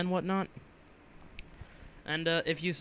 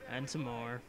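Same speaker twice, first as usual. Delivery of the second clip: lower, softer tag-on, falling away; low, quiet repeat; 4 semitones lower, 6 dB quieter than usual. Pretend. Gamer drags out the waiting joke, low and quiet. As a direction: drop it low and soft.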